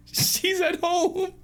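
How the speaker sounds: high, drawn-out falsetto; plaintive high character voice; shy twin. Acted. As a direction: wail it slowly in a squeaky voice. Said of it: "the more shy twin".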